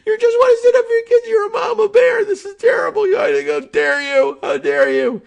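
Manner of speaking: hammed-up outrage, rising to a high shout; mock the fake indignation, shouting high and loud; mock outraged pundit. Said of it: "And then they say".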